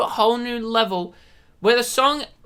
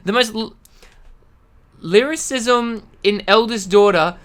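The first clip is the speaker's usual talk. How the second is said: ordinary talk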